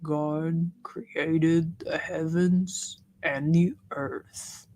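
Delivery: deep, very slow Southern monotone; Drew's monotone Southern voice. Acted. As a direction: read scripture like a sleepy ASMR bedtime Bible. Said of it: "let me do my Drew voice".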